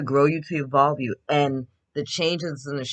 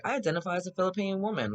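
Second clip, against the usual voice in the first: higher, fast mocking parody voice; mocking impression; 7 semitones higher, 3 dB quieter, faster than usual. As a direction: toss it off as a snide mock quote.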